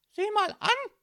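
very high, loud gotcha shout; shrill policewoman bursting in; calling out. A narrator's reading. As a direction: crow it across the room. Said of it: "rief die Polizistin in den Raum".

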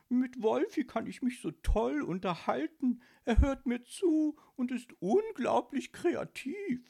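high-pitched, gushing mock imitation; Josephine. Ghost mimicking his elf friend on Wolfi, mocking. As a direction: ape a lady raving about her new friend. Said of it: "wieder äffte er Josephine nach".

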